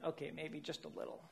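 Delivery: ordinary delivery, nothing marked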